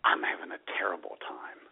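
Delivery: unvoiced whisper of a sad post. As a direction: whisper it like a vague plea. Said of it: "put an innocuous message".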